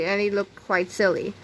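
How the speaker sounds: plain reading voice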